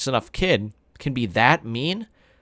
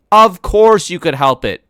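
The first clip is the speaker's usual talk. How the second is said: louder, slow stern scolding; mother scolding her son, louder; 7 semitones higher, 10 dB louder than usual. Acted. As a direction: scold the child firmly. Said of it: "Mom scolded".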